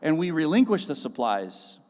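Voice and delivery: preached loud and high, pressing the point; fiery preaching. Acted. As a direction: drive it home to the congregation. Preaching on craft supplies.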